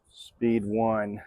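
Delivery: normal talking voice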